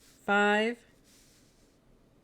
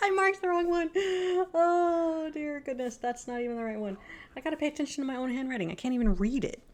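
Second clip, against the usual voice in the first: flustered laughing; 8 semitones higher than usual. Real, not acted. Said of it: "I'm awful".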